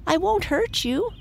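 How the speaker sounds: put-on high-pitched little girl calling out; pleading cry. A narrator's reading. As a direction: call it out high to coax him. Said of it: "cried Millie".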